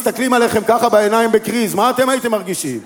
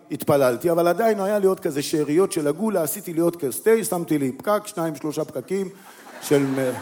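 ordinary talk, nothing marked

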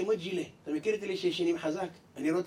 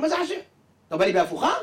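suspicious outburst; 4 semitones higher, 5 dB louder than usual. Acted. Man accuses clerk of tricking him.

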